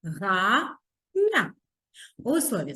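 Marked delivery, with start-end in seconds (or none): 0.0-1.5 Зма-га-ня slowly, syllable by syllable; split into syllables. Shown as a demonstration. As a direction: tap out Зма-га-ня in three slow beats. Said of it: "Поділимо слово на склади". (по складах: Зма-га-ня)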